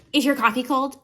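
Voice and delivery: high mock coworker teasing. Mocking coworkers who tease about cold coffee. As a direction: sneer it like a nosy coworker. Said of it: "I get clowned on".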